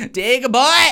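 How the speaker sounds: high, loud cheer. Acted. Cheering on Diego the tortoise, high and loud. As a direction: shout it like a proud fan.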